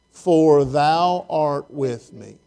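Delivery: each word laid down slowly and deliberately; slow emphatic reading. Shown as a demonstration. Pastor to congregation, stressing the promise, slow and weighty. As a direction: let the verse land word by word.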